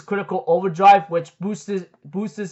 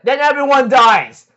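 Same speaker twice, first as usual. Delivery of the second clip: retold threat, louder and higher; mock threat; 5 semitones higher, 6 dB louder than usual. Galen answers the man's refusal, mock loud threat. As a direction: raise pitch and volume for a mock ultimatum. Acted threat in anime retelling.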